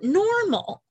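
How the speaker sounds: "normal" drawn out slowly as if in air quotes. Real, not acted. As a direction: say normal with ironic distance, slowly.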